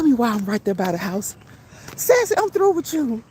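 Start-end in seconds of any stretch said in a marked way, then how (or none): 2.0-3.2 high-pitched, tickled mock telling-off; mock scolding. Streamer teasing Sassy in chat, mock scolding. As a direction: playfully give up on a friend, voice pitched way up.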